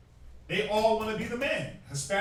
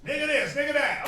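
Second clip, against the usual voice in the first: mock nagging; 6 semitones higher, 5 dB louder than usual. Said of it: "listener laughs".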